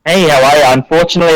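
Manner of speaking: ordinary talk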